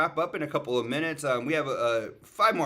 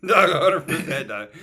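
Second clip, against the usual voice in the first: laughing echo; 7 semitones higher, 7 dB louder than usual. Pretend. Laughing echo of mama joke.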